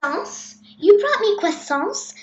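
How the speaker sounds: rising, disbelieving questions; incredulous princess. A narrator's reading. Princess displeased with prince's gift.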